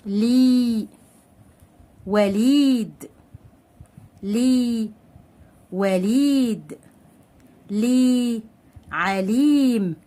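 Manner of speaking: slowly stretched ee in "لي", "وليد", "عليم"; long ya madd. Shown as a demonstration. Teacher lengthening words with madd, drawn-out "لي", "وليد", "عليم". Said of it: "لازم اطول في الكلمة".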